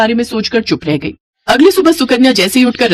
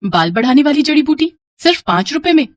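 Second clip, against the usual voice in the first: higher-pitched, intrigued repeat; light, high questioning voice; wondering question; 5 semitones higher than usual. Acted. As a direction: ask it in curious disbelief. Girl repeats the offer, wondering.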